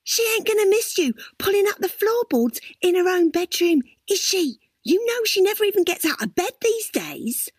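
lightly voiced young woman plotting; Crystal's voice. A narrator's reading. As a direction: voice the scheming young wife. Crystal's voice in the story.

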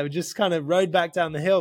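ordinary talk, nothing marked

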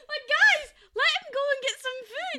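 high, loud pleading shout; mock plea. Yelling at the cartoon guys to let him eat, in a high mock plea.

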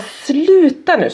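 high, drawn-out exasperated yell; retold parent's shout. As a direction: re-enact a mother snapping at her child. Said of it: "Så jag skriker ju också".

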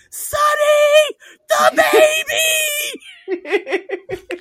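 high, loud panicked shriek through laughter; mother shrieking. Retold or imitated. Mother shrieking from far away at her husband, laughing imitation. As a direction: shriek like a frantic mother in the distance. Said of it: "my mother's voice shrieking".

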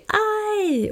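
sudden very high "Aj!" yelp; retold yelp of pain. Needle yanked from her stomach, a high retold yelp. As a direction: squeal as the needle is ripped out. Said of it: "för jag skrek ju till".

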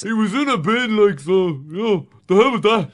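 loud, high mocking outburst; hammed-up jeer with the pitch pushed up; mock heckle. Podcast host mocking a friend's theatre memory, loud mock heckle. Mocking colleague's bin story, laughing.

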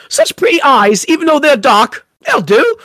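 a greedy fairy purring over pretty eyes, in bad Cockney. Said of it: "worst version of a Cockney".

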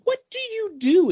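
very high, eager asking voice; eager question. Retold or imitated. Asking Aaron her casserole secret, in a high eager voice. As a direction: ask it wide-eyed and curious.

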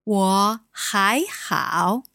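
slow, careful Mandarin wo hai hao; slow model phrase. Shown as a demonstration. Teacher modeling 我还好 for a student, slow and clear. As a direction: model 我还好 slowly for the learner.

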